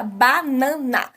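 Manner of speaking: "banana" with every vowel equally strong; ba-na-na in three even, separate beats for "banana"; syllable by syllable. Shown as a demonstration. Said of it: "Todas as vogais ela aparecem da mesma".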